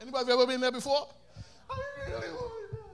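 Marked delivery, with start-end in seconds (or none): 1.7-2.9 put-on high, far-off childish whine; whiny child. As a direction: whine like a kid who wants it now. Child voice whining for something.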